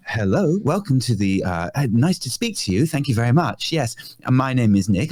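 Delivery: a stiffly polite voice, timbre changed, for talking to adults; polite phone voice. Retold or imitated. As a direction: answer the phone like a courteous little clerk. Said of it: "I had a phone voice".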